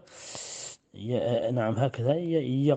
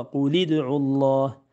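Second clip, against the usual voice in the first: ordinary delivery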